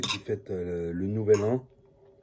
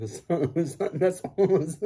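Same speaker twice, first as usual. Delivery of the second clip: squeaky signature voice; 7 semitones higher, much slower than usual. Pretend. Trying out his new signature.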